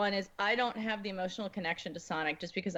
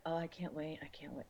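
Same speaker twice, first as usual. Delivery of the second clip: breathless excited whisper; 5 semitones lower, 10 dB quieter than usual. Retold or imitated. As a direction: hush it like a thrilled secret. Excited quote of own reaction.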